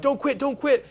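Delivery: high, loud urgent plea; panicked call. Retold or imitated. Calling his wife to stop her quitting, high panicked shout. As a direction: blurt it out in a panic.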